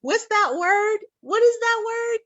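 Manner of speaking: very high, teasing imitation of a questioner; mimicking her questioning. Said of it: "She tell me".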